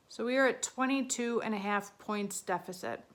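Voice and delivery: ordinary delivery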